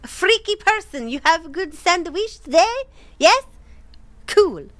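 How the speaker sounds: high-pitched, hammed-up German accent; chatty questions in a silly fake German accent; thick German accent. Talking about his day to the visitor, goofy thick German accent. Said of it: "I started speaking in a thick accent".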